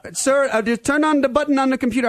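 put-on high Indian-accented scam caller; imitated Indian tech-support voice. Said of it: "with a strange Indian accent".

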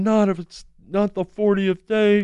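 high-pitched mock wail at normal volume; retold crying protest. Woman protests to the man praying, retold crying. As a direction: imitate a distraught cry, pitched well up. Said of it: "she's crying and screaming".

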